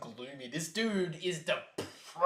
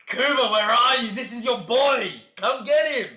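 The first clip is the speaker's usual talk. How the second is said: calling out; 7 semitones higher, 10 dB louder than usual. Real, not acted. Calls out to a character.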